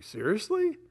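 high-pitched questioning disbelief; incredulous. Retold or imitated. Dad hearing kids tried to hurt his son, incredulous. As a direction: retell it as a stunned, rising question.

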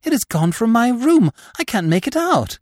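high, bewildered protest; dismay. A narrator's reading. Nephew tells his aunt the knife is gone, dismayed. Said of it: "running back in dismay".

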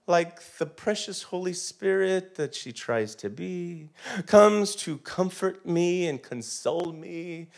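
normal talking voice

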